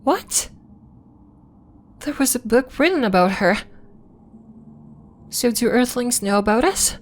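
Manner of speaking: high, incredulous questioning; astonished. Acted. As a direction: voice leaps up in disbelief. Astonished to learn of book.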